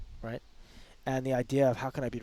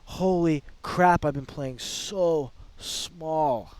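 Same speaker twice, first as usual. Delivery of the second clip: slow, drawn-out amazement, louder at first; awed realization; 2 semitones higher, 4 dB louder, much slower than usual. Acted. Speaker acting out realizing their own limits, amazed. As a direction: stretch each word as it dawns on you.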